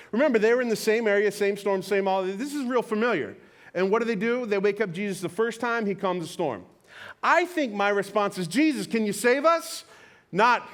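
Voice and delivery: loud, driving sermon delivery; preaching at full force. Real, not acted. Pastor to congregation, loud driving preaching. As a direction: preach it hard to the crowd.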